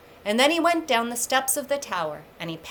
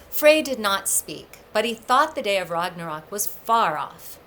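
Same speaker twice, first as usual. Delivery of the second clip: plain reading voice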